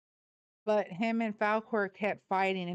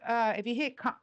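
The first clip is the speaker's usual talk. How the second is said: ordinary talk, nothing marked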